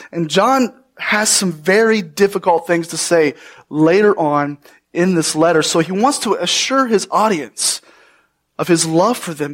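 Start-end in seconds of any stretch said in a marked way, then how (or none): none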